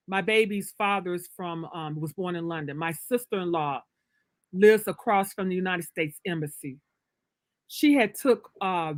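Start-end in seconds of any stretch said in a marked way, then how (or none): none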